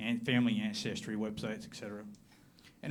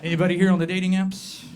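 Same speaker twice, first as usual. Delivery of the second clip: loud question to crowd; 6 semitones higher, 9 dB louder than usual. Real, not acted. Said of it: "repeated fast, quieter".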